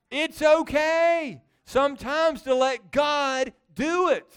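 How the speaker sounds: loud, high, slow preaching; emphatic exhortation. Real, not acted. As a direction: drive it home slowly at high volume. Exhorting the congregation.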